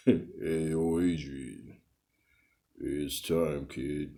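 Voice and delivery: low, soft, slurred Stallone impression; Rocky Balboa. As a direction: mumble it low like the tired boxer. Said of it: "that's my Sylvester Stallone impersonation".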